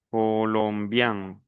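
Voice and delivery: "colombian" very slow, ending on a nasal vowel. Shown as a demonstration. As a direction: stretch "colombian" and finish through the nose. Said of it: "las dos últimas son nasales". ("colombian" lento, con final nasal)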